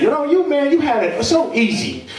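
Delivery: high whiny complaining voice; whiny friend. Retold or imitated. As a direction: whine like a broke buddy. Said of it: "tell me how easy I had it".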